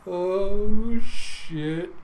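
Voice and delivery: real excited reaction. Real, not acted. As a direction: relish the moment out loud. Delighted reaction to the story.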